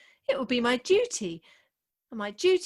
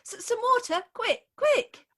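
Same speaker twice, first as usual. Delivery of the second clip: alarmed call; 8 semitones higher than usual. Acted. Bride alarmed at the suitor's faint, urgent call. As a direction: panic and call for help.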